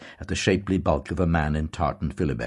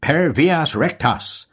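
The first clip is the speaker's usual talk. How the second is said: slow, higher, louder, firm Latin motto; firmly; 11 semitones higher, 7 dB louder, slower than usual. A narrator's reading. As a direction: state the motto with finality. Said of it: "Mister Deasy said firmly".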